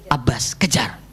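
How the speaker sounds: hushed, breathy command; urgent whisper. Acted. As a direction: whisper the order so no one hears. Secret order in the story.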